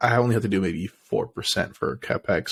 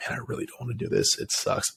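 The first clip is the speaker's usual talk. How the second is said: grumbling whisper; 7 dB quieter than usual. Retold or imitated. Dreading putting off work.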